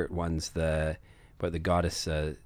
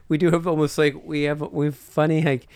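loud, high, laughing excitement; excited; 8 semitones higher, 7 dB louder than usual. Real, not acted. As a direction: burst in loudly, half laughing. Laughing over dwarf Scottish voices.

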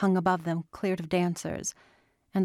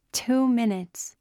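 higher, slow and delighted; excited announcement; 5 semitones higher, slower than usual. A narrator's reading. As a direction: savour the moment it starts. Fountain show begins.

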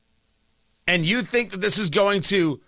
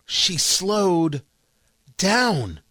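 spaced out, with a long pause before "down"; slow, deliberate emphasis; much slower than usual. Shown as a demonstration. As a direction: stretch "slowed", pause, then land "down". Repeats point, slowing it for emphasis.

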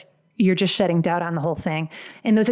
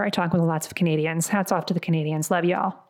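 ordinary talk, nothing marked

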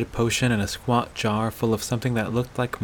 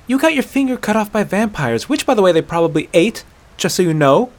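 high, louder, hurried comeback; exasperated retort; 7 semitones higher, 5 dB louder than usual. Acted. Aela answering the doubting hunter, exasperated. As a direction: rattle it off as if it's obvious.

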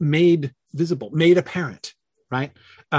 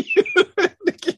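real laugh breaking over the name, much higher. Real, not acted. Teacher to commenter who messed up his pronunciation, laughing. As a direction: say it through a helpless high laugh.

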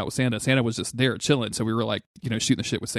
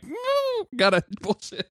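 stifled giggles; slower than usual. Retold or imitated. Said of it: "very much this facial expression".

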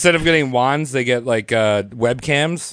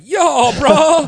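high, loud skater bro; 14 semitones higher, 6 dB louder than usual. Retold or imitated. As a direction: call out like a skater dude. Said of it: "and you're like".

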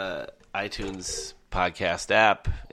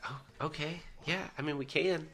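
much softer, higher, unsure; hesitant soft reply; 7 semitones higher, 10 dB quieter than usual. Retold or imitated. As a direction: reluctantly go along, barely voiced. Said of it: "I was like".

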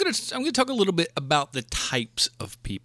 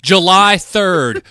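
slow, louder, plodding read-out; mocking a screen reader; 3 semitones higher, 9 dB louder, much slower than usual. Mocking the moviegoer who reads the screen aloud, slow and loud. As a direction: read it out slowly like a dullard. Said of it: "reads everything that's on".

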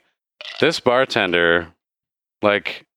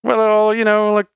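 high-pitched, rambling barkeep; chatty bartender; 12 semitones higher than usual. Acted. Bartender explains wizards to adventurers, high chatty character voice.

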